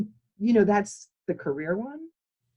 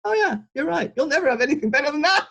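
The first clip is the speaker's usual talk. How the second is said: higher, louder cabbie voice, suddenly agreeing; cabbie conceding; 9 semitones higher, 6 dB louder, faster than usual. Retold or imitated. Cabbie learns it was the Beatles, high and loud agreement. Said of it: "and he goes".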